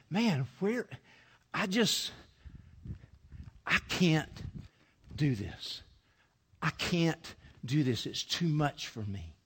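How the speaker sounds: quiet, slow and low, overwhelmed; overwhelmed, softly. Acted. Preacher voicing Mary as she faces the gift, quiet and overwhelmed. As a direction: let it sink under the weight.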